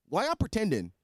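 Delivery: normal talking voice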